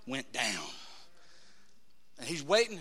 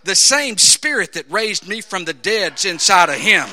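slow, loud preacher's roar; big, deliberate shouted proclamation; forceful declaration; 3 semitones lower, 11 dB louder than usual. Acted. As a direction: thunder it out as the Lord's answer. The Lord answers, acted.

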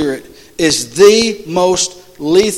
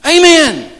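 high, loud pulpit exhortation; building to a shouted affirmation; fervent preaching; 10 semitones higher, 7 dB louder than usual. Real, not acted. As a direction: drive it home to the congregation. Preaches at full force.

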